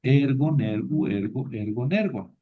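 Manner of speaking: ergon, ergu, ergo stressed on ER; rhythmic drill hitting the ER of ergon; stress on first syllable. Shown as a demonstration. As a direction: keep the accent fixed on the first syllable of ergon. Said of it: "palabra grave y no se mueve el acento".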